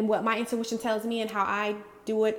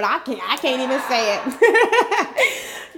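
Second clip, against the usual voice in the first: real laughter through the words; giggling, can't get it out; laughing; 6 dB louder, slower than usual. Real, not acted. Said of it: "I can't even say the word".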